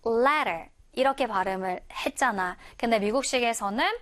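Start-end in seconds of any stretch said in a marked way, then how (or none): 0.1-0.7 "letter" with the t weakened to a tap; American pronunciation. Shown as a demonstration. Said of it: "미국식으로 발음할 때는".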